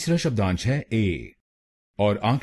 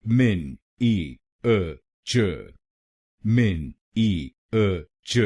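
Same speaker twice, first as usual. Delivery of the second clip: मिन, ई, अ, च said one by one with pauses; slow syllable breakdown; much slower than usual. Shown as a demonstration. Teacher breaks the word into syllables, slow and deliberate. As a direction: spell out मिन ई अ च slowly, beat by beat. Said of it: "शब्दांश".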